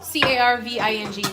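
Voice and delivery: "C A R V I N G" spelled letter by letter, loud; spelling it out. Shown as a demonstration. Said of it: "With a V?".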